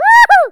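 loud, high mock woo-hoo; celebrating falsetto-high holler; high church whoop. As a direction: whoop like a carried-away worshipper. Acts out tambourine-shaking church whoop.

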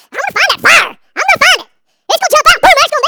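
loud, high, fired-up self-imitation; worked-up hunting cry. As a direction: shout it like you're hunting trouble. Acts out hunting for the fire.

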